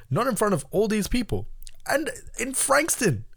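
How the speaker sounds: high, mock-desperate protest; worked-up, high-pitched retold defiance; indignant refusal. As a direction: refuse to die, pitch climbing way up. Acting out refusal to die.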